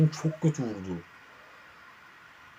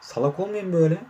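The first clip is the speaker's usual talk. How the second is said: ordinary delivery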